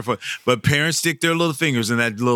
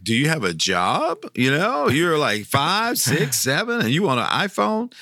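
rising disbelief, voice climbing high; mock outrage at a tiny kid wanting a phone; incredulous; 5 semitones higher than usual. Santa scolding a five-year-old, incredulous and high. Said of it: "I want to say, like".

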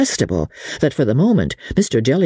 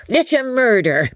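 emphatic repeat; 11 semitones higher than usual. A narrator's reading. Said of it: "he repeated again".

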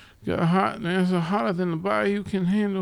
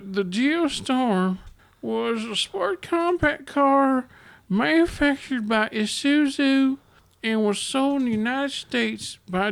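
slow, higher quiz-reading voice; trivia host; 3 semitones higher, 3 dB louder than usual. Acted. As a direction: read it like a quiz card, slowly. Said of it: "real trivia".

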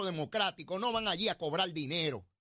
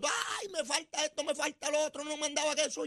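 mock whiny crying; 6 semitones higher than usual. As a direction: imitate a whining crybaby listing grievances. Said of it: "que se pasa llorando". (lloriqueo quejoso en tono de burla)

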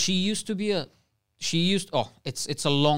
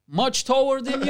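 high jeering mimic with a laugh; lightly voiced heckler, laughing; mock teasing lad; 8 semitones higher, 3 dB louder than usual. Mimicking a passer-by mocking the couple, laughing. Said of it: "a little pause".

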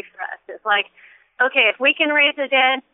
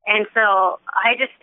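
ordinary talk

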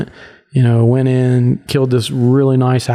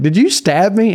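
surprised; 8 semitones higher than usual. Retold or imitated. Startled question to friend.

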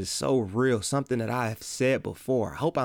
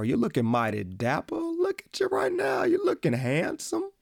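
put-on old-timer compliment.

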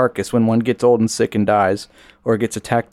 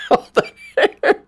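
laughing; slower than usual. Real, not acted. Laughing echo of partner's joke.

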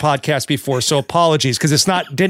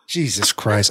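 ordinary talk

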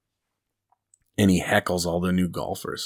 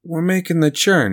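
mock buddies; 10 semitones higher, 4 dB louder than usual. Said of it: "his buddies are like".

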